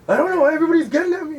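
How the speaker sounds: high, loud retold wail; mock breakdown. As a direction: act out breaking down over being picked on. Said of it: "I just broke down".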